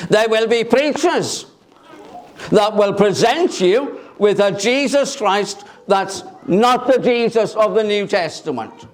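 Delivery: ordinary delivery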